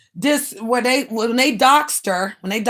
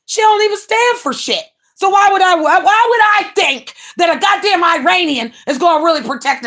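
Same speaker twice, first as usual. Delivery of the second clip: heated rant; 6 semitones higher than usual. Real, not acted. Ranting about a rival to viewers, rising shout.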